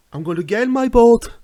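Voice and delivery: quoting the movie hero's defiant speech loudly; Van Damme impression. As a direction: belt it like a hammy action hero. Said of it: "Jean-Claude Van Damme, "I'm not going home" speech".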